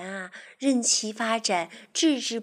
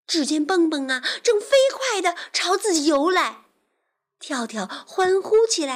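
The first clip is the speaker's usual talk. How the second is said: higher, lively storytelling; children's story narration; 9 semitones higher, 6 dB louder than usual. A narrator's reading. Teacher telling children about a pesky fly, animated narration. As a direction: tell it vividly for little listeners.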